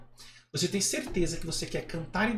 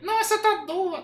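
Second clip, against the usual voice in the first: very high puppet voice; Kleber; 12 semitones higher than usual. Acted. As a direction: protest as a high-pitched dummy. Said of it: "posso fazer uma voz mais grossa".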